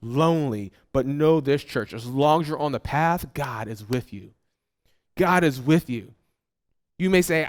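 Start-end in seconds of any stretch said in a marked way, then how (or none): 3.3-4.3 hushed reassurance. Acted. Pastor hushed to church, soft and low. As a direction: drop to a soft, low murmur.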